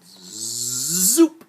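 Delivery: rising zoop. Acted. Repeat magic sound.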